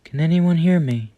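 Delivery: high, loud desperate cry; shouting for help. Acted. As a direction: scream for help from the chair. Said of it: "The girl then shouted".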